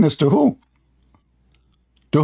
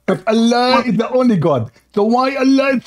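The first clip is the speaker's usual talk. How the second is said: higher-pitched imitation of Zakir Naik's accent; mock Zakir Naik accent; 5 semitones higher than usual. Debater answering complaint about his accent, mock Zakir Naik accent. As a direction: put on the preacher's Indian English accent. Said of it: "Why are you speaking like this?".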